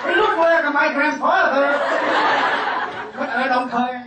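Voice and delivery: hammed-up toast from the bride's father; Quảng Nam accented English. Father at daughter's wedding toasts guests, hammed-up Quảng Nam accent. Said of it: "nói tiếng Anh mà ông nói bằng giọng Quảng Nam".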